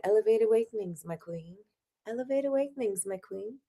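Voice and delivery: lofty greeting fading very soft on my queen; grand and courtly, trailing off; mock regal greeting. Mocking the new greeting, grand then very soft. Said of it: "I should be like".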